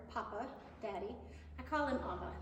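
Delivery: usual speaking voice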